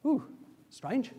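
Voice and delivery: normal talking voice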